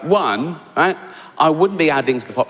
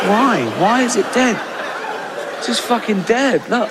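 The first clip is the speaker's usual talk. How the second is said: mock outrage; 6 semitones higher than usual. Mock outrage at dead baby.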